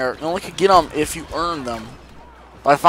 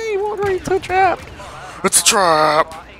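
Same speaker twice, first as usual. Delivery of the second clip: mock movie quote; 12 semitones higher than usual. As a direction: ham up the famous line. Mock Admiral Ackbar quote.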